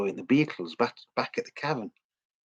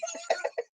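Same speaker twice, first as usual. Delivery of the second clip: short high laugh; a quick amused laugh; 9 dB quieter than usual. Real, not acted. Laugh after rant.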